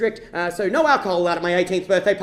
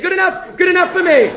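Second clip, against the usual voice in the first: high, loud, excited shout; eager acceptance; 8 semitones higher, 9 dB louder than usual. Acted. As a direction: leap at the offer, pitched right up. Boy jumps at the offer.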